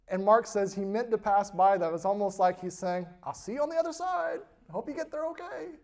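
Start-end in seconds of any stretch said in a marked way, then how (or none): 3.2-5.7 higher, fast, offhand and softer; breezy mock farewell. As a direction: toss it off like a casual goodbye. Casual mock Jesus.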